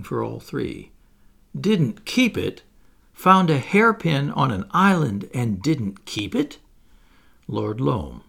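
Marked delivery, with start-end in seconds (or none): 1.6-6.6 incredulous. Acted. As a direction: echo his words in shock. Daughters incredulous at father.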